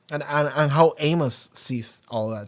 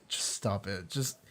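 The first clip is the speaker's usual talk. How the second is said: annoyed; 6 semitones lower, 11 dB quieter than usual. Retold or imitated. Amos shutting down Clarissa's self-pity, annoyed low mutter. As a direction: lower and softer, an annoyed brush-off. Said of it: "it's like an, an annoyed".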